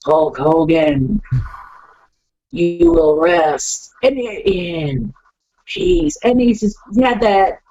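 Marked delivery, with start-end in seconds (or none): 0.1-6.2 slow eerie promo voice, every word drawn out and spaced; menacing manager threatening Hulk Hogan, very slow; Paul Bearer. Retold or imitated. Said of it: "he had that cadence to his voice".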